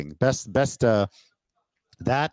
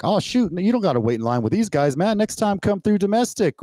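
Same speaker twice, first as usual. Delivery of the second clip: higher-pitched, easygoing officer voice; imitating a relaxed, friendly border agent, pitched up; friendly customs officer; 11 semitones higher than usual. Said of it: "He's just like".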